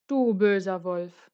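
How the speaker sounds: slow, loud storybook scolding; mildly acted indignation, words stretched; scolding the wolf. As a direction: draw out a stern accusation. Hunter confronts the sleeping wolf.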